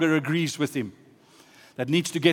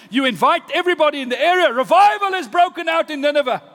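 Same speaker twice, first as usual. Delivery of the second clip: high-pitched excited preacher, building louder; full-force preaching; 9 semitones higher, 5 dB louder than usual. Real, not acted. Preacher celebrating a city's repentance, excitedly preaching. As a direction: build to a triumphant revival announcement.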